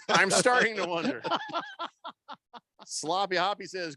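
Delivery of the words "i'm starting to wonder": higher, amused, laughing delivery. Real, not acted. Host joking about listeners' accounts, laughing. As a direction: laugh through the words.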